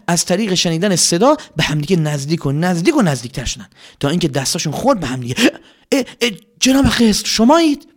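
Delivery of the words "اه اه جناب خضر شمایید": high loud surprised gasps; startled to touch him in the dark; loud high surprise. Acted.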